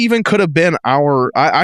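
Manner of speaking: usual speaking voice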